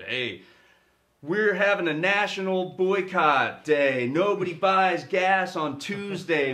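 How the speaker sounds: loud, high, slow rallying cry; mock crusader drawing out every slogan; gung-ho boycott activist. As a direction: shout it like a zealous protest organizer. Acting out a naive boycott email.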